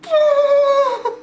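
high, loud burst of real laughter; a loud cackle of disbelief. Real, not acted. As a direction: crack up at the comment.

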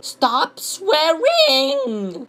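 high, very drawn-out whine; mock whining commenter. Reading yet another stop swearing comment, drawn-out mock whine. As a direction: stretch both words into a silly whine. Same comment read in mocking voice.

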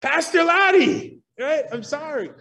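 mock flustered apology, Pastor Laddie called out; a sheepish person backpedalling after cursing; embarrassed apology. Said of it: "they utter a curse word and say".

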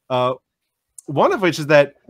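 normal talking voice

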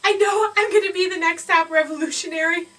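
ordinary delivery

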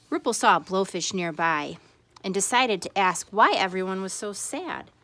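usual speaking voice

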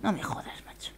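hushed exasperated whisper; whispered dismay. Real, not acted. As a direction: breathe it out in disbelief.

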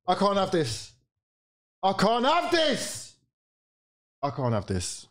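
usual speaking voice

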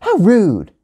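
sharp, high-pitched outburst; indignant yell. A narrator's reading. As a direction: yell it like an offended kid. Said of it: "yelled Gia".

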